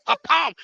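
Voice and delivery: high, laughing impact sound; gleeful impact noise; laughing pow. Retold or imitated. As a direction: punch out the hit through a laugh. Sound of his big splash landing.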